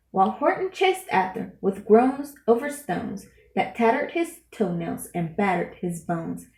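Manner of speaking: usual speaking voice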